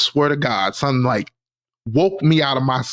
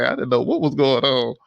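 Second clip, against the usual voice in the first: ordinary talk, nothing marked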